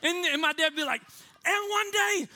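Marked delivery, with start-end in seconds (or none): 1.4-2.3 high, mock pulpit proclamation; fired-up preacher. Dad warning his church, fired-up proclamation. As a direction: imitate a preacher warning the flock. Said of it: "getting fired up".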